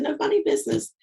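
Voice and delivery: high-pitched scolding quote; a hint of a startled scold; mock sharp rebuke. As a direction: squeak it out as a playful warning.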